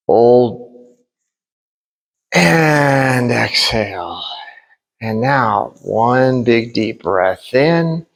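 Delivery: slow, calm breathing instructions; guided breathing. Acted. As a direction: guide each breath slowly.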